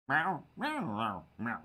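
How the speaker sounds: a cat butting into the argument; cat meowing. Acted. Cat Ripley answers Ted with high meows.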